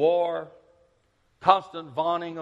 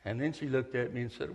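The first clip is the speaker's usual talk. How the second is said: ordinary talk, nothing marked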